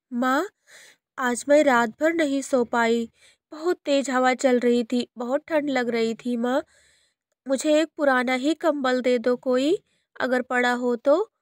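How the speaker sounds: high, meek asking; timid child voice. Acted. Girl timidly asks stepmother for a blanket, high voice.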